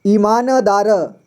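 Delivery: slow "ईमानदार" with न fully voiced as "na"; full न. Shown as a demonstration. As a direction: stretch "ईमानदार" into i-maa-na-daa-ra. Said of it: "न का पूरी तरह से उच्चारण किया".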